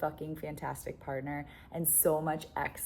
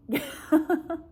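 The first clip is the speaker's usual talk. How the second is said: high laugh; 12 semitones higher, 7 dB louder than usual. Real, not acted. Laughs at her own bluntness.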